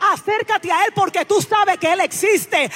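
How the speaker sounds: loud, building exhortation; fervent preaching. Acted. Preacher urging the congregation toward God, building fervent preaching.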